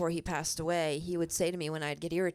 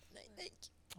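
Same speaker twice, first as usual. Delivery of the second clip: whispered thanks, voice breaking with emotion; barely voiced, holding back tears; choked-up whisper; 2 semitones higher, 16 dB quieter than usual. Real, not acted. Thanking her grieving friend.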